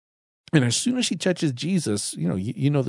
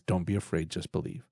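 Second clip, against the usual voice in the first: gentle hushed quote; 6 semitones lower, 10 dB quieter than usual. Acted. Jesus' reply to grieving Jairus.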